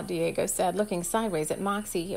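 usual speaking voice

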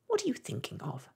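high, stern little mouse scolding; a small creature's stern rebuke, pitched high; severe mouse. A narrator's reading. Mouse scolding Alice for not listening, high and severe. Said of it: "said the mouse to Alice severely".

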